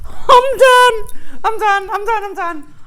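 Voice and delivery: high, loud squealing laugh-cry; giddy squeals over the finished piece. Real, not acted. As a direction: squeal it out, overcome with glee. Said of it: "sounds like someone's murdering a cat".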